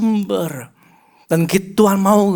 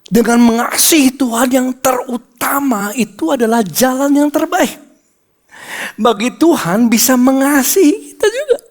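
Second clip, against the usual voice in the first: raised, ringing preacher voice; preaching at full force; 7 semitones higher than usual. Acted. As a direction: proclaim it to the congregation.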